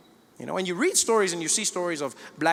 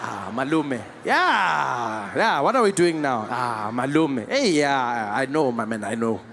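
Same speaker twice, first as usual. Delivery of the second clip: low, drawn-out commiserating tone; a South African cop commiserating with a speeding driver; sympathetic cop; 8 semitones lower, slower than usual. Retold or imitated. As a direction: sigh along with the driver like an old friend.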